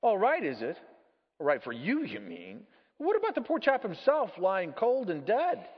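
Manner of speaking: outraged ghost throwing it back; indignant ghost. Acted. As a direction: argue back as an affronted ghost.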